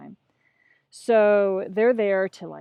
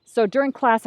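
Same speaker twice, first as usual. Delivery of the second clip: usual speaking voice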